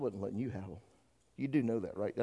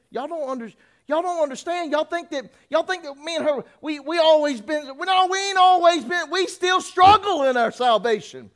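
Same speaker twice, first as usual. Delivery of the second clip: loud, high shouted preaching, building; full-force preaching; 20 dB louder than usual. Acted. Preacher shouting at the congregation, loud and high. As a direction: thunder it out to the church.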